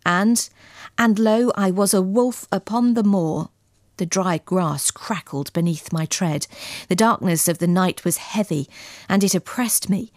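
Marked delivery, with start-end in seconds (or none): none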